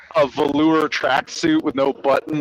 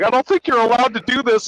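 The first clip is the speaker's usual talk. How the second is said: sputtering; 8 semitones higher than usual. Retold or imitated. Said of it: "sputtering and stammering".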